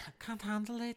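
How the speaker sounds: high-pitched Scouse attempt; Liverpudlian accent. Shown as a demonstration. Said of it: "is this Liver-, Liverpudlian?".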